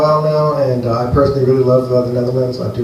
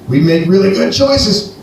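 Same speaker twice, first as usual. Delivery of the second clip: sarcastic; 6 semitones higher, slower than usual. Acted. Sarcastic comic line.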